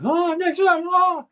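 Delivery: very high, loud drawn-out jeer; mock taunting. Two guys ahead talking trash, mock taunting. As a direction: imitate rivals hollering a taunt. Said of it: "they're, like, talking shit".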